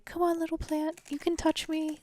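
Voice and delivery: high, sing-song coaxing voice; coaxing a plant. Retold or imitated. Imitating people talking to their plants, high coaxing voice. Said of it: "talk to your plant and you say".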